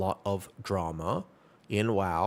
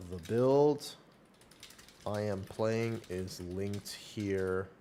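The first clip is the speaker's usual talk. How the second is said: very slow, words spaced out as if typing; typing aloud; much slower than usual. Real, not acted. Streamer typing the build link for chat, slow and absent-minded. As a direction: say each word slowly as you type it.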